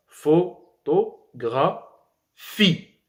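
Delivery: "photographie" slow, syllable by syllable, lifting on "phie". Shown as a demonstration. Teacher showing where tonic accent falls, "photographie" lifted at the end. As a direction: step through "photographie" and raise the final "phie". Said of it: "on lève la voix lorsqu'on arrive à la dernière syllabe".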